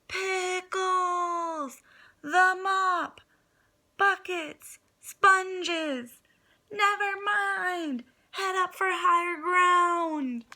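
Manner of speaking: high, loud frantic shouting; panicky scream. Acted. Panicked pig yelling orders in flooding house, screaming. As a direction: scream it as the water rises. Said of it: "a panicky Pete screamed".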